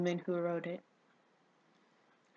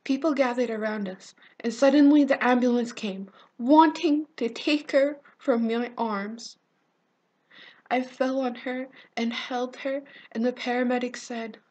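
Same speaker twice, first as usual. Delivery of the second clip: urgent, louder and higher, with stretched key words; distressed dramatic monologue; 5 semitones higher, 10 dB louder than usual. Acted. Survivor recounts finding her friend bleeding after the blast, distressed dramatic monologue. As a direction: relive the horror, building in force.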